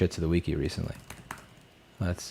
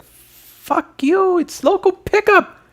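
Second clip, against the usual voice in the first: much higher, slow put-on voice snapping back; mock retort; 4 dB louder, much slower than usual. Streamer mocking his own reply to a customer, high put-on voice. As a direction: fire back in a silly high voice. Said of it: "It's like".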